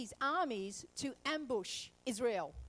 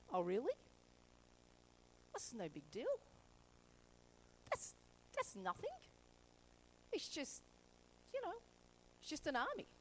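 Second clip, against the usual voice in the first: unbothered; 9 dB quieter than usual. Retold or imitated. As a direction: shrug off the danger. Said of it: "Elijah's like".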